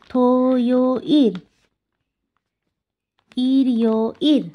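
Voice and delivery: slow, drawn-out syllable-by-syllable model; in three parts. Shown as a demonstration. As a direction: split each day name into three separate, lengthened parts. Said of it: "এটা এই তিন ভাগে হবে".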